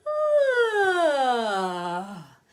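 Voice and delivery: a loud ah sliding up high; rising vocal swoop. Shown as a demonstration. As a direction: let the voice climb upward on one long ah. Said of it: "And then you kinda go up".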